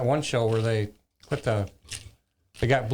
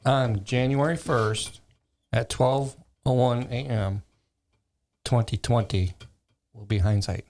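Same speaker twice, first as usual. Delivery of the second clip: slow joke delivery. Shown as a demonstration. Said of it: "Go ahead and say it again".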